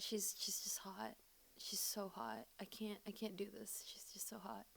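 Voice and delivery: soft unvoiced whisper. Real, not acted. Confessing her crush to viewers, whispered. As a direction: whisper it like a guilty secret.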